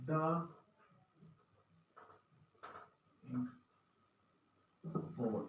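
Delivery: "the ink pot" said slowly, with a long pause between each word; careful word-by-word teaching demo; slow, separated words. Shown as a demonstration. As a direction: space out "the", "ink" and "pot" one by one. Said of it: "लेकिन इसको फटाफट मैंने".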